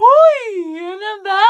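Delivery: high, loud, drawn-out excited squeal. Acted. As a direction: squeal it out in delight. Friend reacts excitedly to makeover. (tuwang-tuwang tili)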